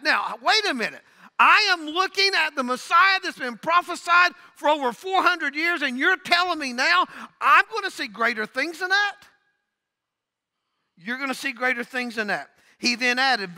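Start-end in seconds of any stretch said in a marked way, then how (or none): 0.0-9.2 incredulous disciple. Acted. Voicing Nathanael's disbelief.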